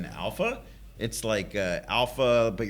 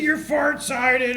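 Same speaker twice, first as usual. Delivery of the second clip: much higher, louder, slow laughing shout; laughing taunt; 7 dB louder, much slower than usual. Retold or imitated. Boy mocks crying sister, laughing hard and loud. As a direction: crow it through laughter. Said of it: "So he just starts laughing".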